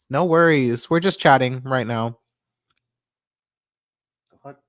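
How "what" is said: normal talking voice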